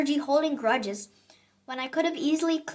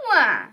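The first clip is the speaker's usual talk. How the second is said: loud wah of frustration; cartoonish loud wah; mock wail; 3 semitones lower, 7 dB louder than usual. A narrator's reading. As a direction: wail like a cranky kid.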